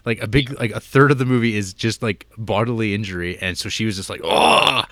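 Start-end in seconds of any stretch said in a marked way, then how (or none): 4.2-4.8 mock horrified.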